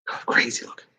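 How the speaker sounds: soft voiceless aside; hushed aside about the actress; whisper. Real, not acted. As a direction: whisper it like a secret. Whispered repeat.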